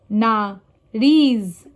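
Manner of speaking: nariz split up, long loud RIZ; last syllable stressed. Shown as a demonstration. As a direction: swell on RIZ: na-RIZ. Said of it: "last syllable".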